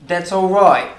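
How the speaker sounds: lightly done Cockney voice; a hint of London Cockney; Cockney accent. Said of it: "a bit of Cockney there".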